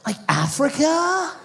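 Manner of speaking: put-on high, slow airy California girl; valley girl voice. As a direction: play the ditzy Malibu surfer girl. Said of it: "She's like".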